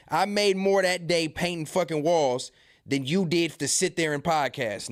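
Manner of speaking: usual speaking voice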